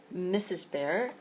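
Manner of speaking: plain reading voice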